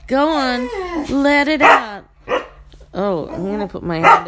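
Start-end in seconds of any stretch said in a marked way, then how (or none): 0.1-2.0 higher, louder goading; loud goading at the dog; loud taunt. Real, not acted. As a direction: urge the dog to let it out.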